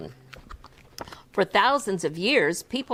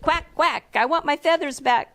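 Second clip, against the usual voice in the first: higher drowsy duck voice; soft sleepy quack; 8 semitones higher than usual. Acted. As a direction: quack it while dozing off. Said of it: "quacked Ducky softly as he drifted".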